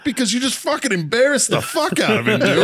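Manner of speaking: high-pitched mock scolding through laughter; mock yell. Sergeant telling off his Marine for embarrassing gunny, mock yelling. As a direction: laughingly imitate yourself chewing him out. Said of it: "He's gonna yell at me now".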